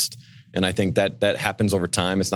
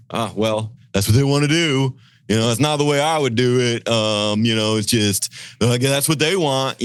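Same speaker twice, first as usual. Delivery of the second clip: mock apathetic grumble; 3 semitones higher than usual. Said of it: "apathetic, "I don't care" attitude".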